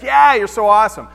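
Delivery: loud, gushing fan reaction; mock admiration. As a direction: exclaim it like an impressed friend. Friends' imagined admiring reply.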